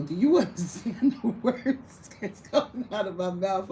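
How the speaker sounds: words breaking into real laughter; cracking up while asking the crowd; laughing. Real, not acted. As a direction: laugh through the question. Laughing at the memory.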